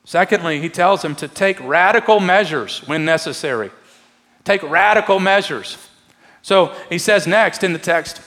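impassioned pulpit appeal; fervent preaching. Acted. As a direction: drive the exhortation home with rising force. Preaching builds to full force.

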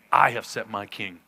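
"I" hit hard at the start, then pitched low; stress on I. Acted. God speaks from heaven of his king, stressing "I". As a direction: lean firmly on the word "I". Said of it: "The I in Hebrew is emphatic".

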